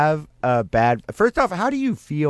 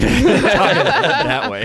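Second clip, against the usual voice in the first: laughing; 10 semitones higher, 5 dB louder, slower than usual. Real, not acted. Laughing banter.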